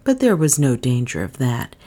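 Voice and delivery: usual speaking voice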